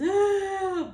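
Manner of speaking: sudden high loud crying wail; boo-hoo wail. Shown as a demonstration. Showing how loud she did not cry, a high wailing boo-hoo. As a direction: let out a big showy boo-hoo sob. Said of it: "boo-hooing crying like that".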